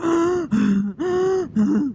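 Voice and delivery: a sharp high gasping breath in; imitating panicked gasps for breath; hyperventilating gasp. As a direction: gasp like you can't get air after running. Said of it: "I can't stop breathing like that".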